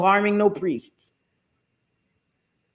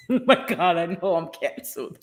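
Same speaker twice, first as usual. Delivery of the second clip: real laughter breaking up the words; laughing hard; 3 semitones higher than usual. Real, not acted. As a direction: talk helplessly through your laughing fit. Cracking up at own blunder.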